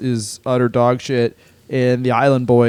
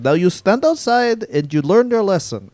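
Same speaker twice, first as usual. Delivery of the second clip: lightly voiced stern bouncer; 6 semitones higher than usual. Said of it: "And he's like".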